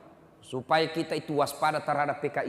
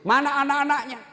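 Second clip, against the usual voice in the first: higher and louder urgent question; surprised question; 12 semitones higher, 7 dB louder than usual. Retold or imitated. Preacher asks the widow in alarm where her children are.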